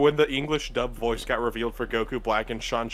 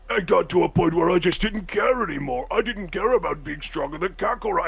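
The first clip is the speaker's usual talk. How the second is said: gravelly, higher-pitched strained voice; Vegeta; 6 semitones higher than usual. Retold or imitated. Showing Vegeta's older voice, gravelly impression. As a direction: rasp it out with gravel in the throat. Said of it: "it's become a lot less gravelly these days".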